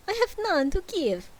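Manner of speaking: high Transylvanian ghost voice; a vampire-ish ghost by accident; Dracula accent. Acted. Ghost answers Scrooge in a high Dracula accent. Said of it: "Apparently I'm Dracula now".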